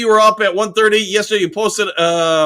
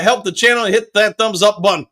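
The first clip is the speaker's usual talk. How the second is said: usual speaking voice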